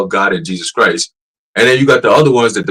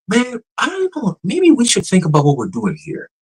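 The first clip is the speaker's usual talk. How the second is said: imitated human-like AI tone; 10 semitones higher than usual. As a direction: mimic a chatbot pondering like a person. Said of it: "the pitch, tone".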